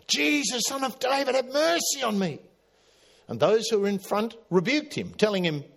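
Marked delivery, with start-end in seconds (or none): 0.1-2.4 high pleading cry for mercy. Retold or imitated. Blind beggar calling to Jesus, high pleading cry. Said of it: "And he cried out".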